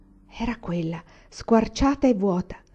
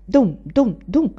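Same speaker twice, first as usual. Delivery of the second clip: loud "dum, dum, dum" like beak strikes; voiced hammering thuds; knocking imitation; 3 semitones higher, 6 dB louder, much slower than usual. A narrator's reading. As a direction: punch out each knock, loud and separate.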